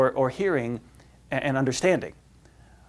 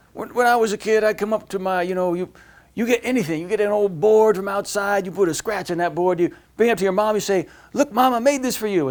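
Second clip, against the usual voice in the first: a lightly done, higher Bill Cosby storyteller voice; Bill Cosby impression; 5 semitones higher than usual. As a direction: tell it like Bill Cosby's skit, a touch higher. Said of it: "what Bill Cosby does this skit".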